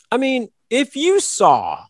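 ordinary delivery

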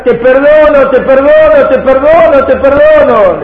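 loud, high-pitched imitated shout. Robbed rabbi calling after the fleeing thief, shouting. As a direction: yell it over and over, as if after someone running away. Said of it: "Empezó a gritar".

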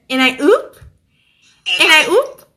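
mock catchphrase. Quotes a meme catchphrase, repeats louder.

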